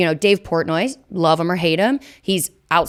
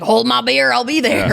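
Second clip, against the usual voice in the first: higher, louder put-on southern drawl; mock southern accent; 6 semitones higher, 4 dB louder than usual. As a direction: imitate a cocky Southerner, pitched up. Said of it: "I don't know why he has a southern accent now".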